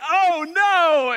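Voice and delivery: high, startled oh no; sudden high groan of embarrassment; mock dismay. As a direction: react to an awkward moment. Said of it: "And I was like".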